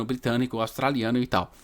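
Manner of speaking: ordinary delivery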